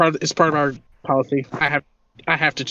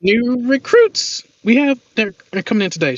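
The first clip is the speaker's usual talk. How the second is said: Angelica introducing; 8 semitones higher than usual. Acted.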